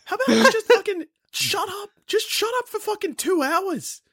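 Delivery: high-pitched, half-laughing outrage; mock exasperation. Audience member to talker at the show, comically exasperated. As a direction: play an indignant theatregoer pushed too far.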